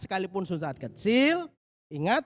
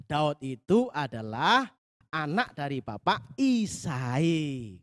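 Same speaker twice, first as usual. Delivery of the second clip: normal talking voice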